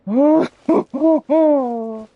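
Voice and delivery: delighted high laugh. Real, not acted. Overjoyed character returns.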